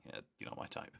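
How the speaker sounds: breathy offhand brush-off; hushed mock brush-off; dismissive whisper. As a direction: whisper it coolly, uninterested. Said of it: "by going".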